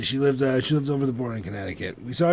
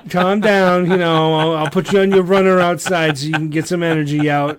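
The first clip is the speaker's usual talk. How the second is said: loud, high pretend scolding; mock pet-owner alarm; 4 semitones higher, 8 dB louder than usual. Owner tries to settle werewolf girlfriend, loud mock panic. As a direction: play a harried owner calling down a wild pet.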